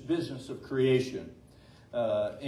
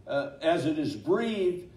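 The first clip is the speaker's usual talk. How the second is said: preaching at full force; 4 semitones higher, 10 dB louder than usual. Acted. Pastor preaching to the congregation on God's breath, fervent. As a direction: build the sermon up, pressing each phrase home.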